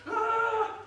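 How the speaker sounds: high, loud operatic "ah"; mock opera-singer outburst; loud opera note. Shown as a demonstration. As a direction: blast an opera note into someone's face. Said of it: "opera singer is quite loud".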